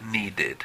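"needed" in two syllables, nee-ded; extra "ed" syllable. Shown as a demonstration. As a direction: pronounce the final "ed" of "needed" fully. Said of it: "ahí utilizo la ed".

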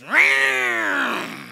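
loud, high mechanical yowl; the neighbor's chainsaw startling them, loud imitated roar. Chainsaw noise breaking in.

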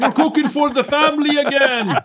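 high, bright-voiced Bahamian shop guy greeting; Bahamian accent. Health food store guy from the Bahamas greets the chef in a high Bahamian accent. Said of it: "guy from the Bahamas".

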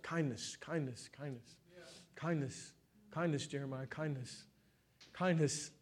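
soft, low murmur to oneself; quiet self-reminder. Retold or imitated. Preacher reminding himself as he walks around, hushed self-talk. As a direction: repeat it under your breath, dropping low.

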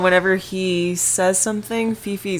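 ordinary talk, nothing marked

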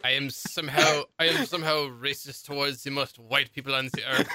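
deliberate, put-on French accent; mock French accent. Co-host joking at their own expense, mock French accent. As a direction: lay on a fake French accent. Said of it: "with this French accent".

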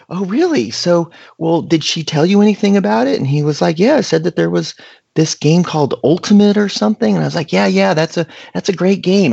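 put-on nervous kid chattering to the cop, high and eager; talking a mile a minute. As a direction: keep the cop on Frisbee, bright and rushed. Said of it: "I'm talking a million miles an hour".

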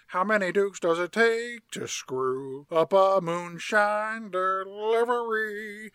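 put-on slow, drawn-out country old-timer; mock Southern patriarch telling a joke; Uncle Jesse. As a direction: draw every word way out. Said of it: "Uncle Jesse says".

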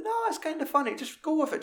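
high mock showbiz breeziness; breezy host. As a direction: brush it off cheerfully. Show host voiced.

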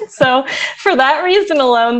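ordinary talk, nothing marked

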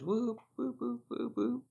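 high, soft mock siren whoops; celebratory whooping. Host cheers the new level five judge, celebratory whooping. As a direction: whoop very high, like a little fanfare.

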